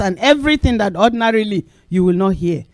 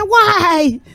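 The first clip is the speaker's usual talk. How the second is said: high, slow, curious question; imitating neighbour; 10 semitones higher than usual. Neighbour asking the preacher where she has been, high and slow. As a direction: ask like a friendly neighbour.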